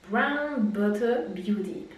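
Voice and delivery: slow, mock English accent on the brand name. Hair vlogger hamming an English accent on a brand. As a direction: say Brown Butter Beauty like an English girl. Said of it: "L'accent anglais".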